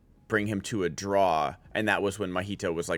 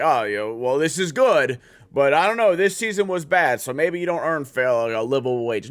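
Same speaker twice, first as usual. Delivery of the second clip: mocking quote; 4 semitones higher, 7 dB louder than usual. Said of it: "I don't even wanna say, like".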